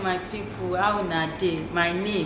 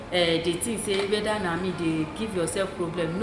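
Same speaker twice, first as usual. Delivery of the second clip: ordinary talk, nothing marked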